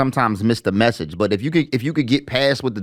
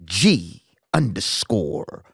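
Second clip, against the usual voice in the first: hype intro; 3 semitones higher, slower than usual. Acted. Podcast host introducing himself after "tell 'em who you are", slow hype intro. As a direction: draw out the big words like a ring announcer, then drop the punchlines down.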